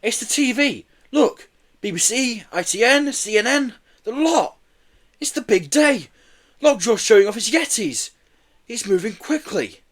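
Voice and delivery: put-on high-pitched, eager boy; excited. A narrator's reading. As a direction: rattle off the big news excitedly. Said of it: "he said excitedly".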